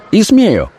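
high, fiery retort; hot-headed. A narrator's reading. Officer retorting to the notary, hot-headed. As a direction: fire it back, pitched high. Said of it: "запальчиво произнёс офицер".

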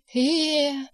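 high drawn-out howl; wordless rising wail of distress. A narrator's reading. Secretary howling at the sight she recalls, high wail. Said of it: "завыла Анна Ричардовна".

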